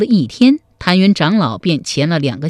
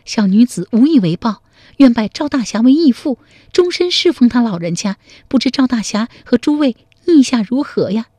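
put-on raised, earnest young woman's voice; a rescued girl humbly offering to serve her saviour; grateful young woman; 5 semitones higher than usual. A rescued girl asks her rescuer to be her adoptive father, raised and earnest. Narrator voices Zhao Jinniang.

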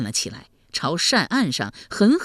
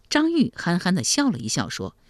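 plain reading voice

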